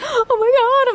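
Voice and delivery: retelling her terror on the plane; mock panic gasp.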